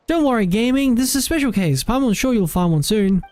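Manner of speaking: high, bright little companion reassuring a friend; Paimon voice. Acted.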